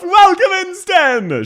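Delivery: very high, loud greeting; called-out welcome. Real, not acted. Streamer welcoming a viewer by name, high loud call-out. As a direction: sing out a high, excited hello.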